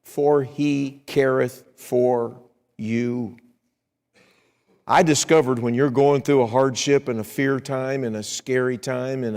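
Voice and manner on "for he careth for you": very slow, deliberate verse reading; preacher reading the verse's reason very slowly; scripture reading. Acted. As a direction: let each word fall gently.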